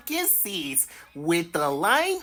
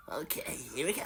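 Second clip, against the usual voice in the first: whispered "here we go"; 10 semitones lower, 11 dB quieter, much faster than usual. Real, not acted. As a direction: hush before the first bite. About to taste seeds.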